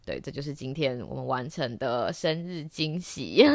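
ordinary talk

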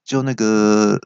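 ordinary delivery

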